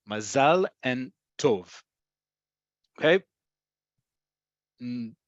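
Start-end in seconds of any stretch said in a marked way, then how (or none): 0.1-1.7 "Mazal and tov" slowly, ma-ZAL and TOV; last-syllable stress. Shown as a demonstration. Teacher breaks down "Mazal and tov", slow ma-ZAL, TOV. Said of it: "emphasis is on the last syllable".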